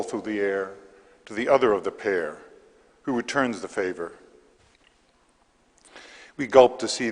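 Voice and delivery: measured verse with pauses between lines; poetry reading. Acted. Poet reads to a library audience, measured poetry reading. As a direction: read the poem aloud, each phrase set apart. Reads own poem aloud.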